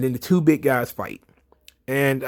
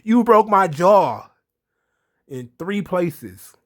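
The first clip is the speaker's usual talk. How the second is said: slow, loud and higher, spitting out each word; menacing threat; 5 semitones higher, 5 dB louder, slower than usual. Retold or imitated. Wrestler threatening the man who broke his jaw, slow loud menace. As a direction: play the furious wrestler promising revenge. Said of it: "he was like".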